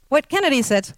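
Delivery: ordinary talk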